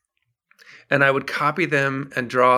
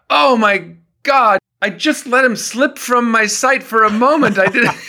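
a frantic minder catching his runaway singer; flustered minder; 9 semitones higher, 5 dB louder than usual. Retold or imitated. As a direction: panicky retold minder, pitched up and loud. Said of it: "bursts into the trailer and says".

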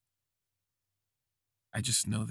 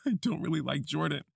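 higher-pitched, said through a laugh; laughing admission; 6 semitones higher, 4 dB louder than usual. Acted. Man confessing he dislikes his hookup, laughing. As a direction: let a laugh break into the confession.

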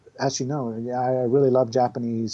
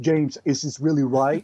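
Arnold impression. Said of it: "Arnold Schwarzenegger is really fun to do".

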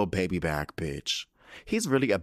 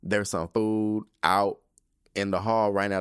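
usual speaking voice

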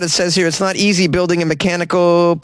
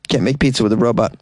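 ordinary talk

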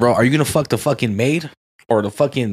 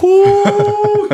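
high squealing ooh; a real excited yelp, way up high; sudden high delighted ooh; 5 dB louder than usual. Real, not acted. Reacts to burning coal question.

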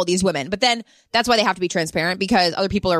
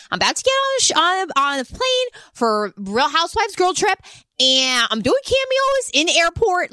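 Sonia; 7 semitones higher than usual. Retold or imitated. Mimicking Sonia filming cameos in an airport, high and shameless. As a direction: imitate an oblivious airport video message.

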